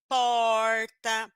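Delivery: PORTA drawn out on a curled-tongue R; syllable-final R. Shown as a demonstration. Teacher giving an example of R ending a syllable, drawn out. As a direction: hold the retroflex R in PORTA. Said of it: "aparece no final da sílaba".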